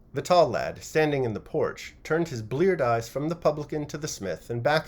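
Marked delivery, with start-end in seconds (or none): none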